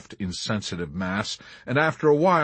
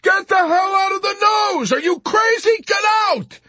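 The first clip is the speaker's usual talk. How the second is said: very high, loud screamed order; tortured amazed scream; 7 dB louder than usual. A narrator's reading. Yossarian ordering Arfi out of the nose, amazed scream. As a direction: scream in outraged disbelief. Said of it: "he screamed at Arfi in tortured amazement".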